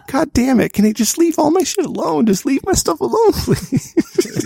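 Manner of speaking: comic squealing exasperation; high exasperated plea. Retold or imitated. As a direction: beg the elves to stop in a high whine. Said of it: "I'm just thinking".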